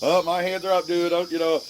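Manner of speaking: teasing, half-laughing excuse to the shooter; joking. Retold or imitated. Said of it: "jokingly trying to break the ice".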